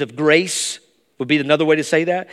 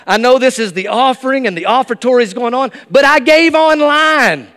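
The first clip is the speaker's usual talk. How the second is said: loud, high, pleading protest to the room; embarrassed outburst; 9 semitones higher, 8 dB louder than usual. Pretend. Churchgoer defending himself to onlookers, loud and high. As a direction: blurt it out so everyone hears you gave. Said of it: "You'd be like".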